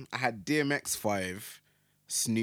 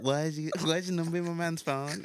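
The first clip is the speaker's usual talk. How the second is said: mock girl, pitched higher; higher-voiced jealous girlfriend impression; prank-call girl voice; 4 semitones higher than usual. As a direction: put on a girl's voice for a prank call. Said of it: "Like, girls, isn't it?".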